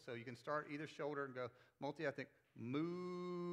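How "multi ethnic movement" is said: slow, swooping multi-ethnic movement; moving voice. Shown as a demonstration. Pastor models a swooping multi-ethnic movement for the crowd. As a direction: let the pitch travel as you say it. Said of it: "we gotta move our voices".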